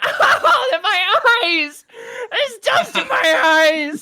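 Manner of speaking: high, slow, loud cartoon wail; wailing goblin. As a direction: howl in hammed-up goblin agony. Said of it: "Scrimblow's going".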